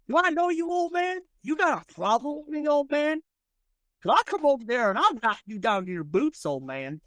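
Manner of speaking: high, swaggering threat; menacing gunslinger. Acted. Franklin Thomas threatening the old man, high swaggering voice. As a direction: square up to him like a braggart.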